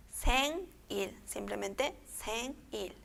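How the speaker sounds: "sen" and "il" kept apart, N not linked; divided syllables. Shown as a demonstration. Teacher shows final consonant not joining, "sen, il" divided. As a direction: say "sen", stop, then "il".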